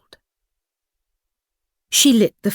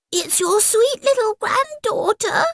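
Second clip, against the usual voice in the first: put-on wolf faking a high girlish voice; sing-song falsetto, pretending to be sweet; high fake voice. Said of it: "sang the wolf in a high voice".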